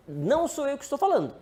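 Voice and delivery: ordinary delivery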